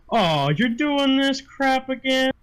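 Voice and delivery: slow, high-pitched complaint to the boss; exasperated bouncer. Acted. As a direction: drag it out, fed up with your boss. Game master voices bouncer complaining to boss.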